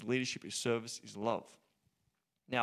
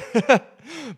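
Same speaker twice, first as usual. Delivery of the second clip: laughing; 12 semitones higher, 13 dB louder than usual. Real, not acted. Laughing at the memory.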